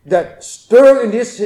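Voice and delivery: ordinary talk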